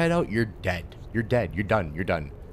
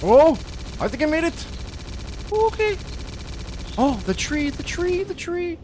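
excited escape. Real, not acted. Gamer escaping his pursuers, high excited shouts. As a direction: cry out in thrilled relief.

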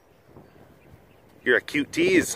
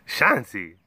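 usual speaking voice

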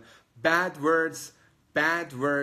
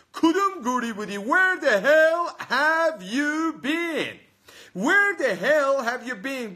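exasperated demand; 11 semitones higher, 6 dB louder than usual. Shown as a demonstration. Teacher acts out the rude question for learners, exasperated. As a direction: demonstrate an outraged question, loud and stretched. Acting out the angry example question.

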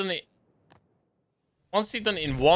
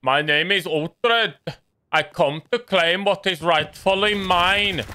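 slow, pompous imitation of the actor's odd accent; mock Uhtred accent; slower than usual. Gamer mocking Uhtred's accent from The Last Kingdom, slow and pompous. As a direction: drag it out like a TV warlord with a strange accent. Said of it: "he comes with the accent like this".

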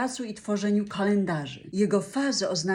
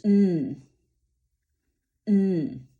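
long nasal hum with lips closed; humming m; 4 dB louder than usual. Shown as a demonstration. Teacher demonstrates the nasal m, humming. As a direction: hum through the nose, lips shut. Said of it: "M to dźwięk nosowy".

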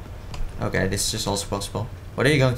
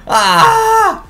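very high loud shriek; sudden yelp; 9 dB louder than usual. Real, not acted. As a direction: yelp in alarm. Sudden yell in game.

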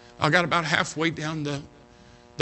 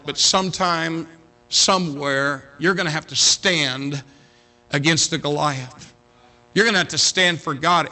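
ordinary talk

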